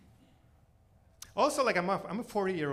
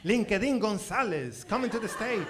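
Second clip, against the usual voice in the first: a touch of a pageant host, a bit louder; mock pageant announcer; 6 semitones higher, 6 dB louder than usual. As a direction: announce her like a beauty contestant. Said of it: "Miss Guatemala's name".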